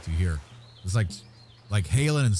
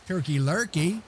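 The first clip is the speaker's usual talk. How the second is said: usual speaking voice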